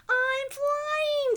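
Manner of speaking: high, loud joyful cry; thrilled shout of a first flight; excited. Acted. Penguin floating on a balloon, excited shout. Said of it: "said Flip-Flop excitedly".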